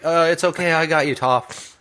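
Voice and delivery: high-pitched imitation of a would-be hero; hammed-up reassurance in a high voice; mock reassuring rescuer. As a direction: call it out high, slowing at the end. Voices Sokka rushing to rescue.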